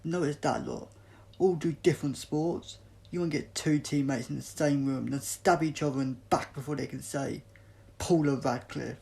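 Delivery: loud bitter scorn; scornful rant. Acted. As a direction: jeer at the rival athletes.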